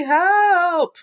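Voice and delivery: high, loud yell for help; Froggy calling for help, loud; panicked cry. Acted. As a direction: scream for help. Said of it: "cried Froggy".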